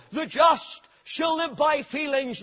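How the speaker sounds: shouted, high; rejected verse. Retold or imitated. Preacher shouting the wrong verse Luther tried. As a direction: fling it out then refute it. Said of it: "that word darted into his soul".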